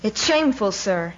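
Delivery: indignant officer's reproach; sharp rebuke. A narrator's reading. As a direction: scold a fellow officer. Officer rebukes the prostrate adjutant.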